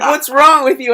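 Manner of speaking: the date snapping at him; imitating her annoyed. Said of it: "she's like".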